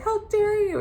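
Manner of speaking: high-pitched mock outrage; mock outraged scene. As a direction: act out a big indignant scene. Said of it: "makes a big scene".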